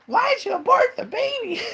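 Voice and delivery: very high hammed-up shriek; screaming and crying. Winnie confronts Will over the baby, mock screaming. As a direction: screech it like a distraught cartoon. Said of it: "She starts screaming and crying".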